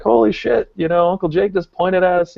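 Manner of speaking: high-pitched thrilled retelling; acted amazement, voice lifted high; excited fans. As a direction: gush it like a starstruck kid.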